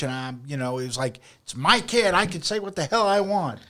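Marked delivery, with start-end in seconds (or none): 1.4-3.6 higher-pitched mock defiance; retold indignant protest. Parent answering coach's disapproving looks, indignant.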